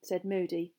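plain reading voice

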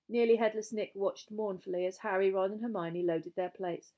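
plain reading voice

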